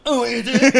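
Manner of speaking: high, loud, laughing sarcasm; mock sympathy. Man answering friends who call trying exhausting, sarcastic.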